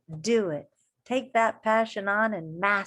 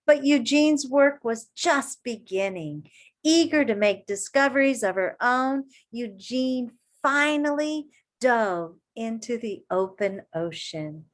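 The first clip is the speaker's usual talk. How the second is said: put-on reading to children; 3 semitones higher, 4 dB louder than usual. A narrator's reading. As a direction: read it to a circle of kids. Reading picture book aloud.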